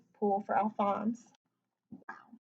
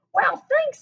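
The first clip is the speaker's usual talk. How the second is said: delighted thanks; 14 semitones higher, 8 dB louder than usual. A narrator's reading. Lewis delighted by gift.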